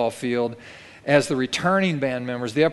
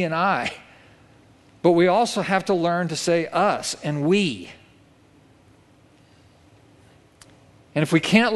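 raised, lifting preacher voice; preaching; 3 semitones higher than usual. Acted. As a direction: drive the point home to the church. Preaching climax.

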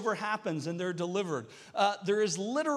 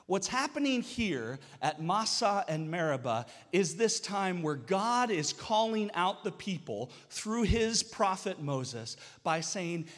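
normal talking voice

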